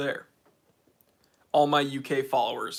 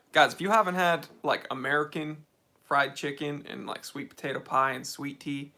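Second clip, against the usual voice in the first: ordinary talk, nothing marked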